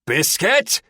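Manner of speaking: loud roar rising at the end. A narrator's reading. Terry to the dog that keeps barking, roaring. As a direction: roar the name, pitched high. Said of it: "Terry roars".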